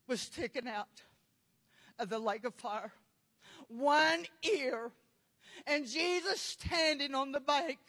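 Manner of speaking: normal talking voice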